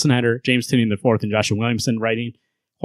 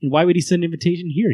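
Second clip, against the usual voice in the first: incredulous hostage; 6 semitones higher, faster than usual. Retold or imitated. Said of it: "And the guy's like".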